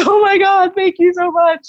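imitating a high, wailing thank-you; squeaky mock-sobbing thanks; overwhelmed, tearful gratitude. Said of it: "And that really set me off".

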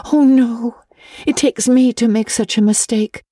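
put-on high girl's voice gone forlorn and self-reproaching. A narrator's reading. Anne lamenting her mistake to Missus Allen, forlorn and high. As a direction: sigh it out as the girl who ruins everything. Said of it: "said Anne forlornly".

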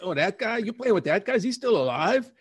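high, astonished disbelief; young brother amazed at the news; incredulous surprise. Retold or imitated. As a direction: squeak out the shock. Retelling shock at brother's news.

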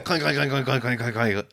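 rapid, even repeated clinks; imitated clinking. Driver trolling the caged workers with a cup, rattling clinks. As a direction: mimic a metal cup rattling along bars.

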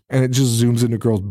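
ordinary talk

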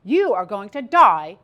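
loud, emphatic doctor's pronouncement; doctor delivering a verdict. Retold or imitated. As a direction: pronounce it forcefully, slowing for the final blow. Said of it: "The doctor said".

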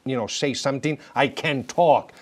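usual speaking voice

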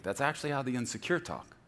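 usual speaking voice

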